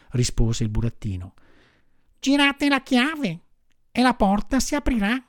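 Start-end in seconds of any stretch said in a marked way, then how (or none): 2.2-5.2 high small voice from inside; little voice. Acted. Voice inside the hut asking who knocks, small and high. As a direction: answer like something tiny behind the door. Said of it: "disse la solita vocina".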